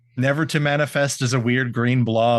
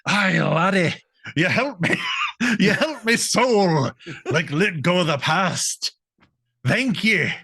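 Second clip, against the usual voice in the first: higher-pitched hammed-up Scottish brogue; thick mock Scots, pitched up; Scottish ghost; 6 semitones higher than usual. Scottish ghost thanks his helper, hammed-up Scottish accent. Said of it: "the ghost of the Scottish".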